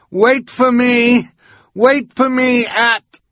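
high, loud, slow shout over water; desperate shouting. A narrator's reading. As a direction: yell to be heard as he's swept away. Calling out as swept away.

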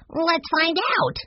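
put-on slow, very high child voice; little boy. A narrator's reading. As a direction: draw it out as an eager small boy. Young Benny proposes eagerly.

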